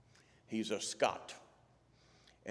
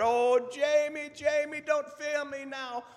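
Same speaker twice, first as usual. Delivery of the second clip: hammed-up Scottish pleading; Scottish doctor's plea; 8 dB louder than usual. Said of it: "and the doctor said".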